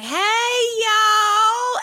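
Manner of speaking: high, syrupy Southern drawl; Southern accent. Retold or imitated. Co-host mimics a longtime colleague, thick Southern accent. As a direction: mimic a honey-dripped Southern greeting. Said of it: "it is still like".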